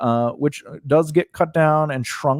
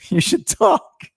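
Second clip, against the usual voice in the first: laughing; 11 semitones higher, slower than usual. Real, not acted. Laughing while talking.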